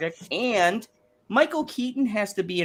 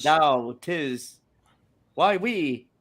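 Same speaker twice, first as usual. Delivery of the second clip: put-on Old English accent; 6 semitones higher, slower than usual. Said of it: "the worst Old English accent".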